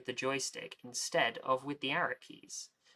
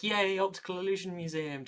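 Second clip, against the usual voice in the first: excited cheer; 6 semitones higher, 4 dB louder than usual. Retold or imitated. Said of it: "just as excited as all the kids".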